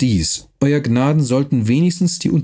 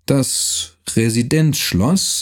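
slow, drawn out, each word left hanging; "Das Residenzschloss" spoken slowly with long gaps; long pauses between words; much slower than usual. Acted. Count to Neumann, dragging out "Das Residenzschloss" with pauses. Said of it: "mit langen Pausen zwischen den Wörtern".

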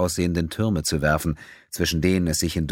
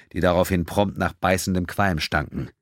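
plain reading voice

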